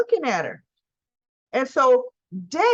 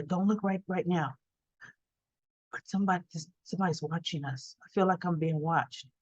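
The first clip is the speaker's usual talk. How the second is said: softer, low-key retold murmur; a lightly voiced hushed aside; hushed warning; 5 semitones lower, 8 dB quieter, faster than usual. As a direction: keep it down so he won't hear. Friend whispering about a watcher.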